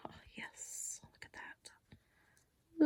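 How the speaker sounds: very soft, drawn-out whisper to yourself; hushed private delight; admiring whisper. Real, not acted. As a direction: whisper it slowly, admiring your work. Admiring the card to herself.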